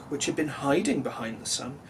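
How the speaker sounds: ordinary delivery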